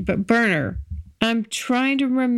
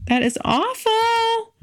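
slow, much higher That is awful, awful drawn out; high mock horror; 13 semitones higher, slower than usual. Real, not acted. To listeners, embarrassed at forgetting a date, high and slow. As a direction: squeal it in playful dismay.

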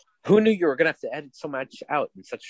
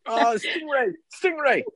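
much higher, put-on moaning cry; mock climactic cry; 12 semitones higher, slower than usual. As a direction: squeal the name in mock passion. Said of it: "she just yells the wrong name".

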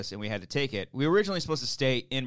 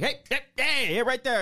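high, louder hailing a car; calling out; 13 semitones higher, 5 dB louder than usual. Retold or imitated. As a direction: flag the driver down, voice up high. Calling out to the Uber.

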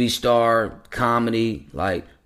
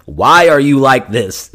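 loud, drawn-out exasperated question; Drewski; 3 semitones higher, 10 dB louder, slower than usual. Retold or imitated. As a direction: quote it as an incredulous outburst. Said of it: "like, with Drewski saying".

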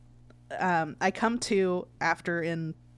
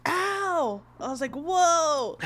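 surprised; 9 semitones higher, 4 dB louder, much slower than usual. Retold or imitated. Pain while dressing.

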